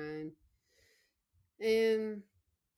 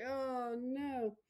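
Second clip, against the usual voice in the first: a touch of crying; 7 semitones higher than usual. Retold or imitated. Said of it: "I was just crying like".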